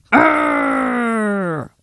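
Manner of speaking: hammed-up angry arrgh; frustrated roar. Namor losing his temper, hammed-up roar. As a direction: let out a cartoon growl of rage.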